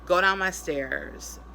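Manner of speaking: usual speaking voice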